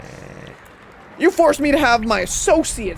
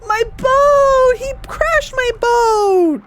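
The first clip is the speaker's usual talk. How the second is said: dismayed shout; 12 semitones higher, 4 dB louder, much slower than usual. Real, not acted. Boat crashed, shouting in dismay.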